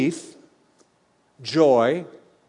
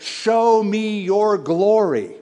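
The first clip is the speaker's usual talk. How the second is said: slow, higher pleading plea; pleading Moses; 5 semitones higher, much slower than usual. Retold or imitated. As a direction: beg slowly to see the glory.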